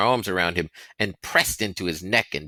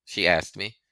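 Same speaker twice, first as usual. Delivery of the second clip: usual speaking voice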